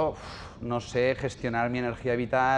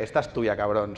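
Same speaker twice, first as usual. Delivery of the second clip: low, quieter mock accusation; a wife muttering a dig, a touch lower; wife's jab; 7 semitones lower, 4 dB quieter than usual. Wife pinning the blame on him, low mock jab. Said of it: "me mira mi mujer y dice".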